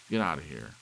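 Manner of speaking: angry order. Acted. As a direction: throw him out of the house. Husband throws out visitor.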